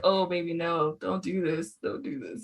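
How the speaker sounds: sinking to a low, soft plea; mock gentle dismay. Fan recalling reaction to Tatum's accent, soft mock dismay. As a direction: quietly beg someone not to embarrass himself.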